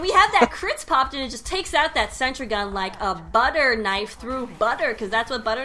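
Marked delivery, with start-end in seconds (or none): 0.0-1.1 raised pitch, louder; higher voice. Shown as a demonstration. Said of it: "I'll go higher then".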